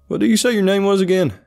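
put-on high, loud country-accented question. A narrator's reading. Seatmate asking the man's name, country accent. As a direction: ask brightly in a country accent. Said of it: "he spoke with a thick country accent".

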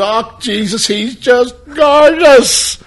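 high, very slow gushing coo; mimicking the mother. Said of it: "she turned him at the end".

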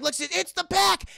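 high, excited villain reveal; hammed-up high-pitched reveal; mock dramatic announcement. Hyped announcement of villains' arrival.

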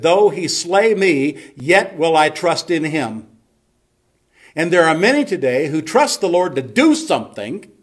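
emphatic pulpit proclamation, pitched high; full-force preaching. Real, not acted. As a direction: drive each phrase home to the congregation.

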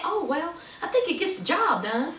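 soft shrug reply. Retold or imitated. Soldier brushing off the complaint, soft and dismissive. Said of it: "Soldier said".

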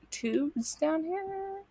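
usual speaking voice

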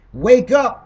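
loud, high wake-up call. Real, not acted. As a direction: jolt the crowd awake. Urging the audience about danger.

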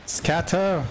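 high-pitched warning cry; urgent call. Real, not acted. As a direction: call out high to make them flee. Calls teammates to flee.

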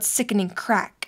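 usual speaking voice

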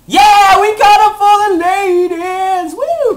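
high, loud victory whoop; jubilant, drawn-out cheer; triumphant shout. Real, not acted. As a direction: yell it out in celebration. Celebrates a catch.

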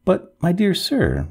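hesitant, drawn-out protest; reluctant. A narrator's reading. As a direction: object politely, dragging the words. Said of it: "with a pale reluctance".

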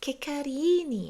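high, very slow, delighted coo. Retold or imitated. Teacher cooing at cute people walking by. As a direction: coo over someone cute. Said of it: "ก็แบบ".

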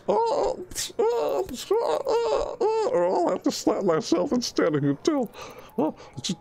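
high giggly moans; hammed-up squeals through laughter; laughing falsetto. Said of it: "it's so naughty".

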